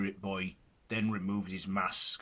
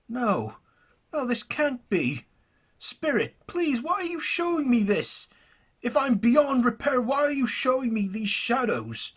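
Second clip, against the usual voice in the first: high, loud, anguished begging; desperate pleading; 6 dB louder than usual. Acted. Jackson begging the spirit to stop the vision, anguished. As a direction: beg the spirit as if your life depends on it.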